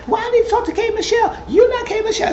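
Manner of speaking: high fast mock voice; mock mom. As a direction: squeak it out quickly and pushily. Voicing Mom Lyrica arguing.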